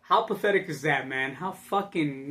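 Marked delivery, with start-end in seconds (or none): none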